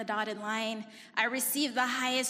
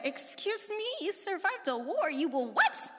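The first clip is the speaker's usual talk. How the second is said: higher, disbelieving excuse me; incredulous; 7 semitones higher than usual. Retold or imitated. Granddaughter answering grandma's threat, incredulous and higher. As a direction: react in disbelief. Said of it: "And I was like".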